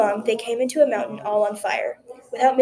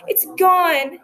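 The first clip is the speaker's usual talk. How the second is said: a high, loud cry of loss; anguished cry; 8 semitones higher, 4 dB louder than usual. A narrator's reading. Widow sees the brocade fly away, crying out high and loud. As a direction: cry out as the treasure vanishes. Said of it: "cried the widow".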